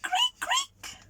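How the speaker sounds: high, thin creak sound; squeaky wheel creak noise. Acted. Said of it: "the hamster's wheel went creak".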